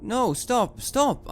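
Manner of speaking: high, urgent plea to stop; urgent protest. Acted. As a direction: cry out to halt them. Character tries to halt the soldiers.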